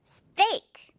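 스테이크 as one high English syllable. Shown as a demonstration. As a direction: say 스테이크 as steak, tight t, projected. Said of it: "더 크게".

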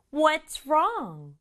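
ordinary talk, nothing marked

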